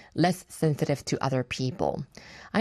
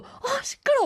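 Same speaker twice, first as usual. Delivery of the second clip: much higher, whiny mock complaint; annoyed grumble. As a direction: grumble about the noisy table. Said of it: "막 계속 이러고 있는 거예요". (시끄러운 옆 테이블에 투덜대는 혼잣말, 높고 짜증 섞인 목소리)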